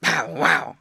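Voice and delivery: low, loud barked words; dog bark. A narrator's reading. Yard dog interrupting the snowman, barking. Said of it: "barked the yard dog".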